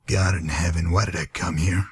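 normal talking voice

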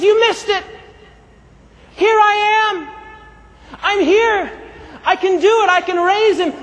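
high, impassioned appeal; urgent proclaiming. Acted. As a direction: call out to people who fail to notice. Acts Jesus pleading to be noticed.

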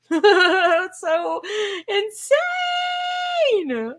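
laughing, rising to a high yell; laughing shout. Real, not acted. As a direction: crack up in disbelief and shout it. Laughing in disbelief at bill.

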